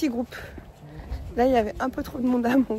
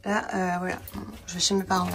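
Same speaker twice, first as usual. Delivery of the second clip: ordinary talk, nothing marked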